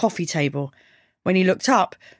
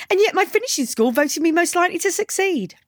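high, mock-proud girl character; cheeky girl's high-pitched retort; sarcastic girl; 11 semitones higher than usual. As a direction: toss back a sarcastic boast in a high female voice.

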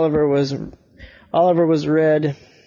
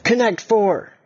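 excited blurt; 8 semitones higher than usual. Acted. Oliver blurted out.

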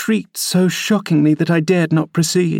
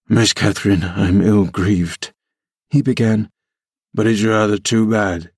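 put-on rough young man; 5 semitones lower than usual. A narrator's reading. Hareton pleads clumsily.

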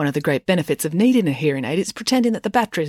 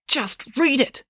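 high, tight, urgent hiss; 8 semitones higher, slower than usual. A narrator's reading. Mayor orders Dad to read, hissing. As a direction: hiss the order under pressure. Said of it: "hissed the mayor".

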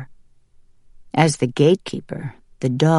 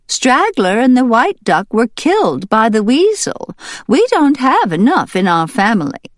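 higher, louder, measured duck voice; gracious duck leader; 9 semitones higher, 4 dB louder than usual. Acted. Duck leader reasoning with the barn, high and gracious. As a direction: plead kindly but firmly for the duckling. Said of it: "in a gracious voice".

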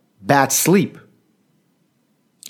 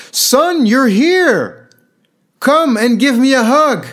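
much higher and louder, gleeful greeting of a forgetful dad; delighted old father; 12 semitones higher, 5 dB louder, slower than usual. Retold or imitated. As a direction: greet your son as if seeing him for the first time. Father with Alzheimer's greeting son.

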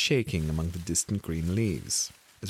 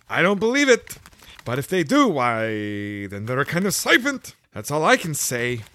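fussy pigeon; 7 semitones higher, 6 dB louder than usual. A narrator's reading. The pigeon complains to Alice about serpents, high and fussy.

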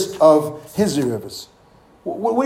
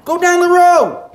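high, loud brush-off; mock shout sending him off; dismissive shout; 10 semitones higher, 5 dB louder than usual. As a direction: yell him out the door. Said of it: "Don't trust the man of God".

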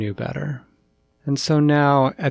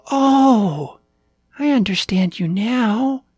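high, loud puppy voice; excited little puppy; 11 semitones higher, 6 dB louder, slower than usual. A narrator's reading. Puppy finally understands the cat, high excited voice. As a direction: pipe up high like a delighted young pup.

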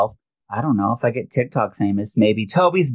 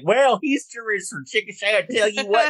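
put-on Oklahoma accent; 11 semitones higher than usual. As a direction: ham up a thick Oklahoma twang. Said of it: "I don't think that I have an accent".

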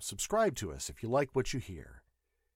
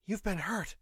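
crying out in concern; 10 semitones higher than usual. A narrator's reading. Nurse notices the pilot's injury, alarmed. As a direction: exclaim it high with mild alarm. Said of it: "Gray cried".

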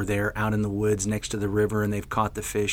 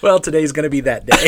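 laughing; 5 semitones higher, 9 dB louder, faster than usual. Real, not acted. Laughing through bloopers.

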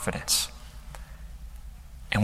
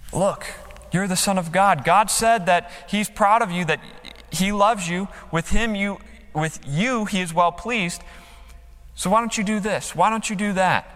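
tempting devil; 6 semitones higher than usual. Acted. The devil goading Jesus to boast, taunting and high. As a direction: coax and goad like the tempter.

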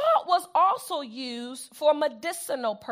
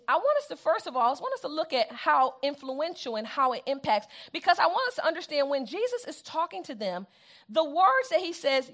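ordinary delivery

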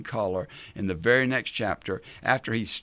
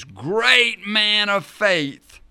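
loud, high, drawn-out mock reverence; scornful sarcasm; 9 semitones higher, 8 dB louder, much slower than usual. Real, not acted. Preacher mocking self-pitying Elijah, loudly sarcastic. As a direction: proclaim the title with heavy sarcasm.

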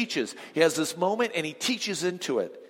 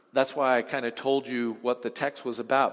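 ordinary delivery

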